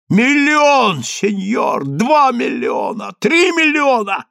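high, escalating cries; frantic bidding. A narrator's reading. Rabbi offers millions to stop Daniil, frantic bidding.

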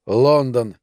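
ordinary delivery, nothing marked

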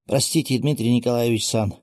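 usual speaking voice

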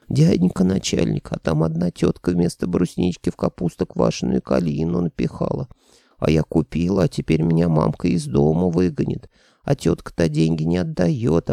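put-on squeaky whining girl. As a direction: whine like a pitiful child. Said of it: "завыла писклявым голосом". (писклявым голосом)

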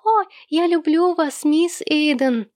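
high mock declaration of love; high, drawn-out lovesick parody in a child's voice; piercing childish mimicry. A narrator's reading. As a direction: squeal it like a teasing small boy. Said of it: "пронзительно вскрикнул малыш Сэм".

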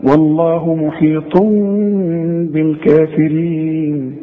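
والله محيط بالكافرين slow and even; full recitation. Shown as a demonstration. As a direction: recite slowly, as in tajwid. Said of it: "إنما هي نطقها إيه".

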